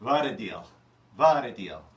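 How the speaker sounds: hammed-up sales pitch. Chef Stan hawking corned beef as his Eastern European self, loud. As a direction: shout the bargain at the customers. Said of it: "this version of Chef Stan".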